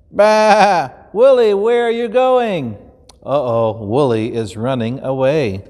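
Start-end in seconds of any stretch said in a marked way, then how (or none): 0.1-0.9 high bleating baa; lamb bleat. Acted. Lamb bleating as it runs off. 1.1-2.7 higher worried call to the lamb; calling shepherd. Acted. Shepherd calling after the lamb, worried.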